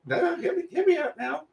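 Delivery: high, giggling hear me out; laughing plea. Real, not acted. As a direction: plead through laughter.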